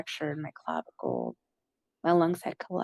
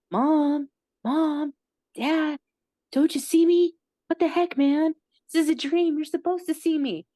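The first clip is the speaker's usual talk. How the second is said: much higher, louder pleading shouts; calling out desperately; 9 semitones higher, 6 dB louder than usual. Retold or imitated. Trying to get her unresponsive parents to see her, shouting. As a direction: yell to be noticed, getting desperate.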